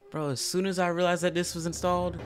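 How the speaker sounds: ordinary talk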